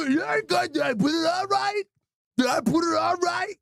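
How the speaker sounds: hammed-up dim student asking anxiously; dumb son voice.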